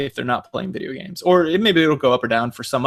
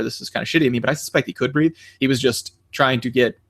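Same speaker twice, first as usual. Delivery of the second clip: ordinary talk, nothing marked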